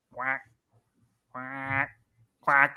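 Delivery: soft, quiet duck quacks; quacking. Pretend. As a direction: quack like a duck under your breath. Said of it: "fantastic quacking there by, by you".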